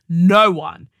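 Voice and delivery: loud punchy no one; firm loud no one; emphatic. Real, not acted. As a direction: hammer it home. Emphatic repeat in the letter.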